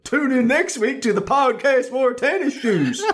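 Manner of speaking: high, laughing put-on voice; Robbie impression. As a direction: go way up and crack into laughs. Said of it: "in your best Robbie impression".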